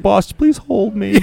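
high, loud, drawn-out fake plea to a boss; mock pleading. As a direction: tease with a hammed-up needy whine. Mocking co-host's wish for hugs.

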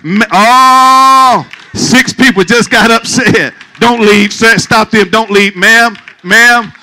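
loud, high, hammed-up pleading with the leavers; mock pleading. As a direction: call after them as they walk out. Pleading with people storming out.

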